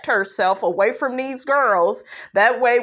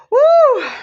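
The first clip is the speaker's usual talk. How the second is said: a high, loud whoop of laughter; 7 dB louder than usual. Real, not acted. Vlogger cracking up at Marlo's joke, loud high whoop. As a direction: let out a big high hoot as if you can't hold it in.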